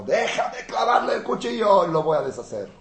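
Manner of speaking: a general begging to strike; shouting minister of war; angry general. Retold or imitated.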